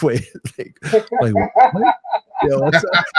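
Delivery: baffled, high and breaking into laughter; laughing disbelief. Retold or imitated. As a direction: retell your bafflement through laughter. Laughing over retold surprise call.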